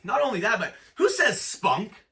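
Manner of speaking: ordinary talk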